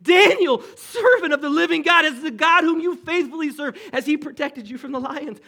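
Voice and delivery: high, loud anguished cry into the den; a king crying out, fearing the worst; anguished calling out. Acted. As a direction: call desperately into the darkness. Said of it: "calling out to Daniel in an anguished voice".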